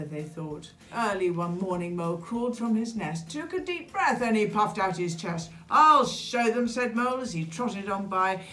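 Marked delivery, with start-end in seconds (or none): 5.7-6.7 plucky, louder vow to prove yourself; raised, resolute little outburst; determined. A narrator's reading. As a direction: declare it boldly with puffed-out chest. Plucky mole makes a vow.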